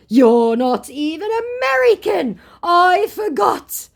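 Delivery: high, loud, drawn-out taunt; hammed-up sneering put-down; mocked scammer. Scammer taunts her for not being American, mocking.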